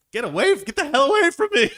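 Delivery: high, loud panicked cat yell; repelled cat. Acted. Female cat fleeing a smelly skunk, repelled high yell. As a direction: shriek it as a cat fending someone off.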